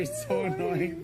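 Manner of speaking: laughing teasingly. Real, not acted. Teasing a friend who is crying.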